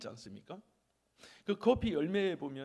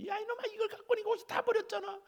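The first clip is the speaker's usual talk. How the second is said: very high, fast mock scolding; mother scolding; faster than usual. Mother scolding son over stained clothes, high fast scolding. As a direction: rattle off a high-pitched telling-off. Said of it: "엄마가 혼내".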